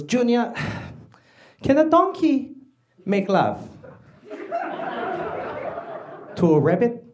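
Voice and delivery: imitating dad's accented English, with long pauses; dad delivering a proverb piece by piece; solemn dad. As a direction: pose a riddle as a stern dad. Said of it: "words that came out of my dad's mouth".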